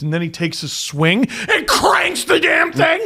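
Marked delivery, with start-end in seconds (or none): 1.5-3.0 high, excited storytelling shout; hyped-up retelling. Retold or imitated. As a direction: play up the big hit. Builds into the son's triumph.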